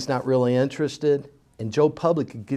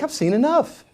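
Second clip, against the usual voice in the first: fed up; 13 semitones higher, 4 dB louder than usual. Retold or imitated. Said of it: "I'm like".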